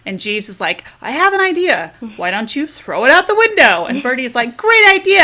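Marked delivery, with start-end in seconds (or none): 1.0-3.9 high, louder acted-out suggestion; Jeeves's bright suggestion. 4.6-5.2 high, loud mock enthusiasm; Bertie's eager agreement.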